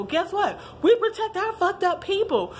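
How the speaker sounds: hyped up about her community, rising high and heated. Real, not acted. Riled up, voice climbing high. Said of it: "I'm getting fucking hyped up".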